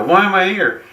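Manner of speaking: high, bewildered "why am I here"; incredulous question. Retold or imitated. Retelling asking the doctors why he survived, high and incredulous. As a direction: ask it like you can't believe you're alive.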